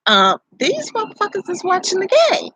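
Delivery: squeaky high disbelief, voice jumping up; high mock outrage. Mother in labor mimicking her own outrage at the staff, high and squeaky. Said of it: "I'm like".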